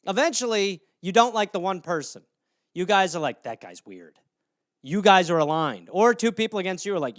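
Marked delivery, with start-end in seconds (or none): 3.4-4.2 gossipy. Retold or imitated. Roommates gossiping.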